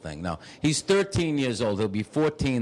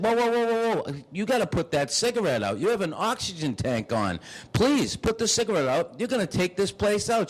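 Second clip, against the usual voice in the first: alarmed; 7 semitones higher than usual. Retold or imitated. Alarmed at smoking oxygen lady.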